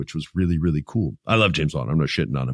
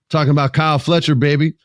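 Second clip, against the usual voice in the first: 1 hype shout-out; 7 semitones higher, 4 dB louder than usual. Real, not acted. Hyping a favorite wrestler.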